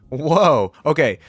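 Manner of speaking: loud, startled exclamation; a sudden surprised outburst; surprised whoa. Real, not acted. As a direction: react like the kick caught you off guard. Sees the kick reveal.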